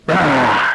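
loud, sudden bear roar. Acted. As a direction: roar as you pounce. Bear pounces on the daddy.

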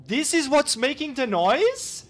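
high, loud astonished question; shocked exclamation. Real, not acted. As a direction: gasp it out in shock. Discovering the noisy creature.

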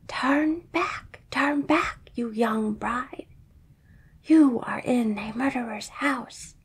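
whispering bird. Acted. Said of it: "It cried out again".

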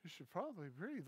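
soft, low-energy suggestion; dazed, listless advice; imitated lethargy. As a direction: offer it sluggishly, as if in shock. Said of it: "like, lethargic".

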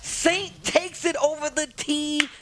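high, loud caster yell, then slow; excited shout. Real, not acted. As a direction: call the winning moment. Hype call on match point.

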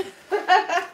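short bubbly laugh; amused laugh. Real, not acted. Amused by the surprise gifts.

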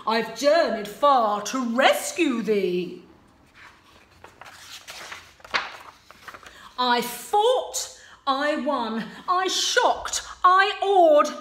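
lightly voiced proud prince; boastful prince. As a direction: puff up like a show-off prince.

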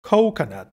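"Coconut" with a full "ou" in the first syllable; clear O. Shown as a demonstration. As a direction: lean on COH in "Coconut", then fall. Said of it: "es importante aplicar el O". ("Coconut" marcando la O de la primera sílaba)

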